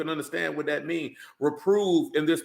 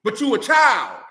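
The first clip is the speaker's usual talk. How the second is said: loud, higher rebuke; scolding shout; 6 semitones higher, 6 dB louder, slower than usual. Real, not acted. Preacher scolding grown men, loud shout. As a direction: shout it down at them.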